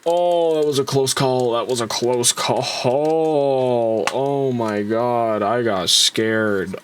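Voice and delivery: breathless relief after a scare; relieved. Real, not acted. A fan sighing after the model nearly fell. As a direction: let it out slowly, still shaken.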